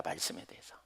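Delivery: a whispered afterthought. Acted. Preacher whispering an aside about the Word. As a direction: breathe it out softly.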